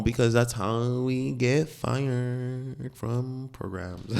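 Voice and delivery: drawn-out mock voice; slow put-on voice. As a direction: stretch it out slowly. Slow far voice.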